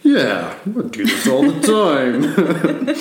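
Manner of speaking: high, cocky brag breaking into laughter; overconfident, laughing. Retold or imitated. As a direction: brag like it's nothing, then laugh at yourself. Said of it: "who I so confidently said".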